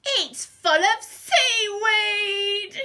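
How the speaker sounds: slow, high outraged wail; drawn-out, very loud villain's cry; shout. A narrator's reading. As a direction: shout it in horrified disbelief. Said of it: "shouted Len".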